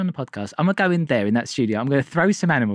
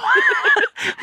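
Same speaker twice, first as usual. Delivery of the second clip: breathy laugh; 3 dB louder than usual. Real, not acted. Laugh at own joke.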